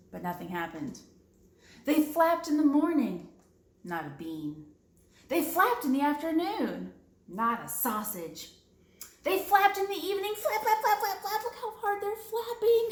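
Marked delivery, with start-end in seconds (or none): 1.9-3.3 flapped said high and loud; excited narration. A narrator's reading. Reading to kids, rising excitement. 9.2-12.9 very high, fast, eager flapping; frantic excitement. A narrator's reading. Reading to kids, wildly excited cheer.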